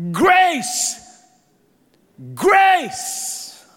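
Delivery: shouted grace. Shown as a demonstration. Preacher shouts grace at the headstone, full force. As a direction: bellow grace at the stone. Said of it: "shout grace".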